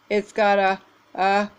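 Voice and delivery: halting pre-sneeze voice; words caught by a coming sneeze; about to sneeze. Acted. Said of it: "When he stopped sneezing".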